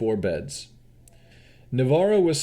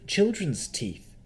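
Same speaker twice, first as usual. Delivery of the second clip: shudder; 8 semitones higher, much slower than usual. Acted. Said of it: "she said with a shudder".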